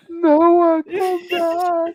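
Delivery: high, pleading call through laughter. Real, not acted. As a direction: beg him back, mock crying. Calling Noah back, laughing.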